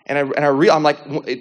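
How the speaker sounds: ordinary delivery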